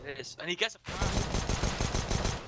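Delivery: high hype shout rising; big play-by-play hype call; excited caster yell. Real, not acted. Caster erupts as Pansy frags.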